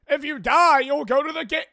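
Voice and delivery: loud, high, overblown sermon; mock cult preacher. Mocking the cult's promise to the host, loud and high. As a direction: belt it out like a ridiculous prophet.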